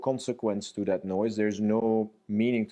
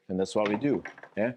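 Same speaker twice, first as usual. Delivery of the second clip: ordinary talk, nothing marked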